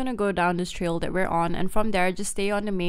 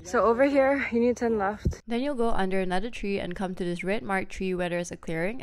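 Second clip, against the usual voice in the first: ordinary talk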